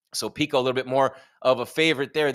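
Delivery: ordinary talk, nothing marked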